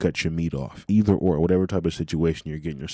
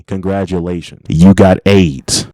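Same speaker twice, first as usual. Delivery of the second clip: drawn-out loud reveal; loud slow punchline; 13 dB louder than usual. Real, not acted. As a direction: shout it slowly for effect. Punchline announcement.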